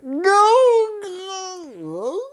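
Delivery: high anguished cry of No. Retold or imitated. Recalling first watching the scene, high anguished cry. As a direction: wail it out up high. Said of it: "I was like".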